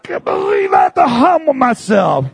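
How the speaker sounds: high, shouted squealing whine; squealing complaint. Retold or imitated. Believer griping while writing the apology letter, high squeal. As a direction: whine it like a stuffed pig. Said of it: "you're squealing the whole time like a stuffed pig".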